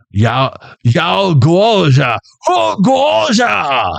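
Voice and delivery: drawn-out mock-Chinese shout, climbing high; hammed-up angry Chinese shout. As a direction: build to a high, slow, angry Chinese cry. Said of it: "in an angry Chinese voice".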